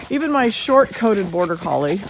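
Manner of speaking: ordinary talk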